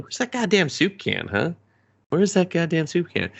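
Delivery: high-pitched mock puzzlement; mock searching. Fan mimicking a thought he wouldn't have, mock puzzled. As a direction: pitch it up, pretending to hunt around.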